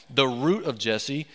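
ordinary delivery

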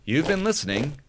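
announcer voice. Acted. Podcast outro sign-off.

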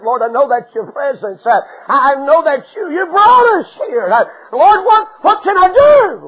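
desperate plea. Acted. Moses crying out desperate.

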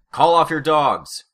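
loud, slow, furious shout. Acted. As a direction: yell it across the room. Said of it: "Brian shouted".

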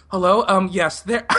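imitating a panicked high-pitched caller; squeaky-high retold phone hello; nervous high voice. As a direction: pitch it up, timid and halting. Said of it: "my voice is, like, ten octaves higher".

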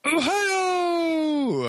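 high, stretched mock cheer of Ohio!; mock hometown cheer. Cheering the listener's hometown with a high drawn-out call. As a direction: sing out Ohio! like a cheering crowd.

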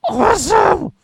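delighted. Real, not acted. Reacting to kids joining hobby.

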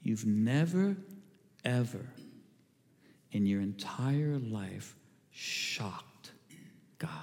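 slow it right down and let each word land. Acted. Preacher repeats it more slowly.

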